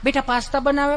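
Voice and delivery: doting mother. Retold or imitated. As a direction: coo at the little boy like a fond mom. Mother coaxing. (લાડ કરતી mummy)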